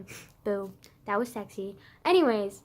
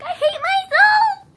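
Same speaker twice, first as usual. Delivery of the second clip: high, loud, slow cringe shout; embarrassed shout; 13 dB louder, slower than usual. Real, not acted. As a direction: yell it out of embarrassment. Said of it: "I'm fucking cringy as fuck".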